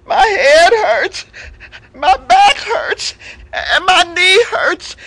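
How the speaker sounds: high, slow, whiny moan; milk the pity like a fake invalid; feigned sick lion. Lion lists fake pains.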